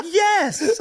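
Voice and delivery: high squeaky laughing yes; mock girlfriend. Imitating his girlfriend answering him, high and laughing. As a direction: squeak it out like her.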